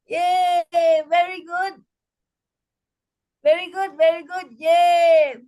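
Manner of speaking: chanted praise with long yays; celebratory "very good, yay" refrain; cheer. Shown as a demonstration. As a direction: cheer the group on. Cheer closing the laughter exercise.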